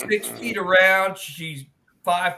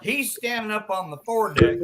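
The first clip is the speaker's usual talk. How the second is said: ordinary delivery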